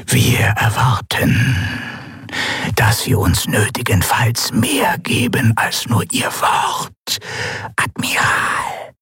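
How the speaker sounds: slow, drawn-out whispering threat; the governor's whispered warning to the admiral; cold whisper. Acted. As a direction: let each word hang in a hush. Sylravan's voice answering.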